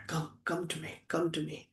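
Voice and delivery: soft, tender calling to a brother. Acted. Rama calling Lakshmana to embrace him, soft and tender. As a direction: gently beckon him into your arms.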